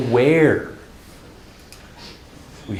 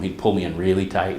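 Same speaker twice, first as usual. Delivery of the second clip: usual speaking voice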